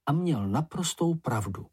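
ordinary delivery, nothing marked

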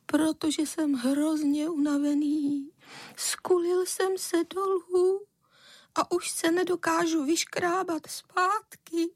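high, slow, drawn-out wail; tearful boy. A narrator's reading. Exhausted boy explaining his fall to mother, tearful. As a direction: stretch it out in a high acted whimper. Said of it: "ale pak se dal do pláče".

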